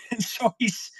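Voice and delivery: laughing through the words; a real laugh breaking into the words. Real, not acted. Laughing at his own blunder.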